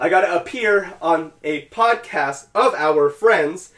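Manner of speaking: over-enunciate each word after a fumble; deliberate redo. Real, not acted. Careful redo after stumbling over it.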